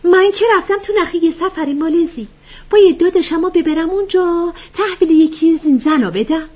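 high-pitched comic character voice; playful joking. Acted. Joker quipping to the women about her brother, playful. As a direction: pipe up high with a cheeky quip. Joker interrupts with a mock plan.

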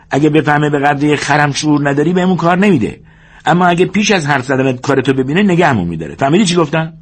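high-pitched bossy minder laying down rules; George, instructing. Acted. George drills Lennie on meeting the boss, insistent.

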